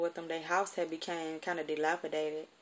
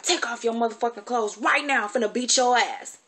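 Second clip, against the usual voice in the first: high, loud furious command, imitating her aunt; furious shout; 4 semitones higher, 8 dB louder than usual. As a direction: yank the kid up and yell. Auntie before the whipping.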